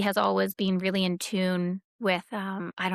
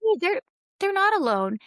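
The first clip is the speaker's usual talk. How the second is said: imitating her insistent son; 10 semitones higher than usual.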